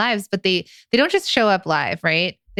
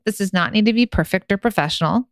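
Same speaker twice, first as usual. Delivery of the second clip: ordinary talk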